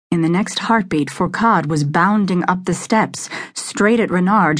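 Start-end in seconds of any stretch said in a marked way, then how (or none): none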